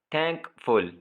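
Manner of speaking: "Thankful" in two separate beats; syllable by syllable. Shown as a demonstration. Teacher counting syllables, "thankful" split in two. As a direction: pause between thank and फुल in "thankful". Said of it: "इसमें भी दो syllable".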